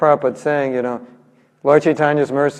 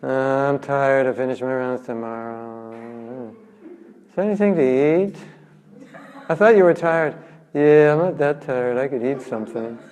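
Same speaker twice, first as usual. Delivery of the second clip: tired excuse; 3 semitones lower, 4 dB quieter than usual. Retold or imitated. Lazy devotee avoiding chanting.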